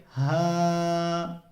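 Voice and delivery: हा said slowly and simply; plain simple ha. Shown as a demonstration. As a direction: say हा plainly, stretched a little. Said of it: "बिल्कुल simple तरीके से".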